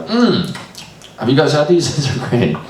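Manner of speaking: jovial funeral guest. Retold or imitated. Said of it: "I was, like, eating the cheese board".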